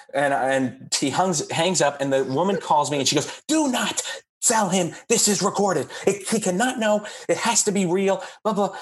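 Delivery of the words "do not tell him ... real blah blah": much higher, insistent and commanding; urgent warning. Retold or imitated. Producer on the phone insists the call stays secret, higher and insistent. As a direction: lay down the rule, no arguing.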